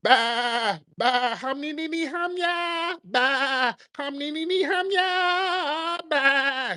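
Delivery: squeaky high babble of nonsense syllables, "Bah, bah, ham, ni, ni, ni"; mock tent noises. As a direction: chirp the gibberish way up high, mocking. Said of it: "we're hearing".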